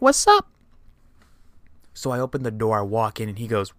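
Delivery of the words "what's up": high, loud, cheery "what's up"; bursting in with a loud, high hello; mock greeting shout. Barging into the bathroom, a loud high cheerful greeting. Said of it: "be like".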